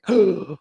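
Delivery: sharp mock gasp of alarm; a quick startled intake of breath; retold gasp. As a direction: gasp like you've just been caught. Reacting to mom coming.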